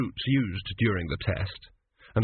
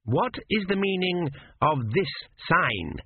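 cold examiner; 6 semitones higher than usual. A narrator's reading. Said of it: "said Meriset".

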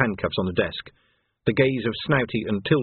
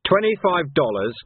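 firm, raised-pitch demand; firm ultimatum; 6 semitones higher, 4 dB louder than usual. Acted. Vimes demands pay from the captain, firm. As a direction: lay down the demand squarely.

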